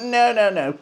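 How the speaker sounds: high mock-official "no"; mocking refusal. Mocks the FA's refusal.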